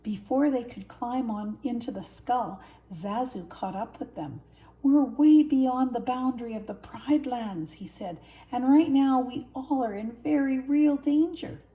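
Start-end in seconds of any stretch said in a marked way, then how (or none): 4.8-11.6 loud alarmed warning; worried hornbill warning loudly; fretful bird. A narrator's reading. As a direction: fuss and fret over the danger. Zazu warns the cubs.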